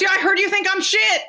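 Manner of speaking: imitating a fighter's mock yell; retold yell. Fighter yelling down the phone at her, mild mock yell. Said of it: "into my fucking ear".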